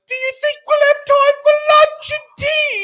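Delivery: very high, loud sing-song hobbit; silly Pippin. Retold or imitated. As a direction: trill it like a clueless hobbit. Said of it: "Pippin will be like".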